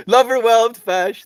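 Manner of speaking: loud, slow laughing name read; delighted laughing read. Real, not acted. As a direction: cackle out the username. Laughing reading username.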